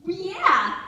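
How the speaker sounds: high, loud imitated child; emphatic son. Son confirms it to mom, loud high-pitched child. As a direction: blurt it like an exasperated kid. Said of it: "He's like".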